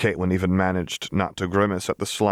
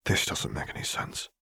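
breathy under-the-breath mutter; 4 semitones lower, 8 dB quieter than usual. A narrator's reading. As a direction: grumble it to yourself, barely voiced. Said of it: "Caitlin muttered".

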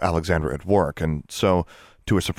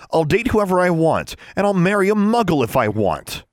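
high, loud declaration; heated defiance, voice raised; defiant; 12 semitones higher, 5 dB louder than usual. A narrator's reading. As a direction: insist angrily on your own choice. Defiant quarrel with her brother.

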